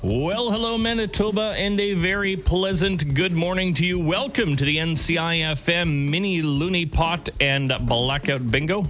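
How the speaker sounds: bright, upbeat on-air welcome; radio announcer. Real, not acted. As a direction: greet the province like a morning host. Radio host opens bingo show.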